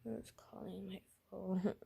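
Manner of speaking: soft, weary sick-patient voice; Robin. Acted. Sick character answering the phone, soft groggy voice. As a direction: say it quietly and weakly, as if ill. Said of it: "this is Robin talking right now".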